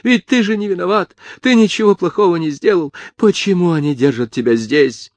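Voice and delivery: high-pitched, agitated protest; crying out. A narrator's reading. Mother telling her arrested son he is innocent, crying out. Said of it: "закричала Чепурнова".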